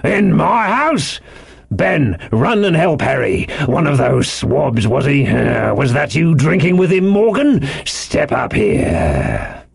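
indignant landlord barking orders, drawn out; high outrage turning to slow command; stretched, blustering sailor voice; crying out. A narrator's reading. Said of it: "cried Silver".

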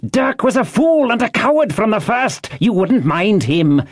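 high, angry rebuke; scornful cry. A narrator's reading. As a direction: berate the cowardly crew at high pitch. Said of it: "cried Pew".

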